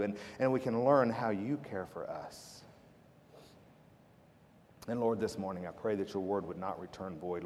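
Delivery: low, hushed prayer voice; congregational prayer. Real, not acted. Pastor praying with the church before the message, low reverent prayer.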